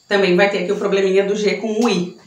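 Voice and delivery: ordinary talk, nothing marked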